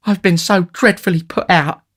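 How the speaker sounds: high, loud lady's complaint; distressed lady. A narrator's reading. Matron tells the beadle her upset, distressed and high.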